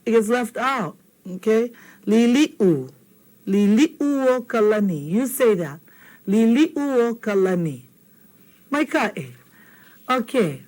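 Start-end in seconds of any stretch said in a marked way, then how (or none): 3.5-7.8 slow Liliuokalani with the oo sounded; Liliuokalani, careful and complete; correct Liliuokalani. Shown as a demonstration. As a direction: give the u in Liliuokalani its place. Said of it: "See this oo?".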